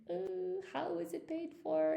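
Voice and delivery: mock bewildered, quiet and high; puzzled surprise. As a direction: ask it as if you can't believe it. Retold confusion at the bar.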